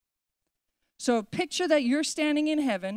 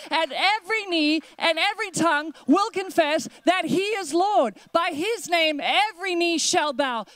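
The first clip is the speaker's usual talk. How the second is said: building, higher and louder sermon climax; preaching at full force; 4 semitones higher, 3 dB louder than usual. Acted. Pastor proclaims to the congregation who Jesus is, building to a shout. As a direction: lift the room, each line bigger.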